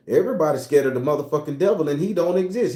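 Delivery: ordinary delivery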